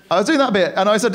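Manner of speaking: usual speaking voice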